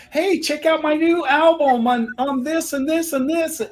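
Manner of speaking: high-pitched celebrity ad read; mocking high-voiced promo imitation. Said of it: "[name] on a commercial".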